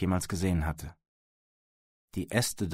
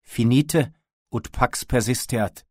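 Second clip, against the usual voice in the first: slow, raised, commanding shout across a distance; higher, louder, drawn-out foreign warning; powerful call; 4 semitones higher, 6 dB louder, slower than usual. A narrator's reading. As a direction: call it out slowly in a strong voice. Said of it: "rief mit kräftiger Stimme".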